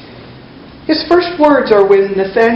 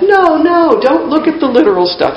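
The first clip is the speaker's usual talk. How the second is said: high-pitched, exasperated correction; acted exasperation, pitched up sharply on the first words; 7 semitones higher than usual. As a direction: cut off a too-literal listener. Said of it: "Jesus is pretty exasperated".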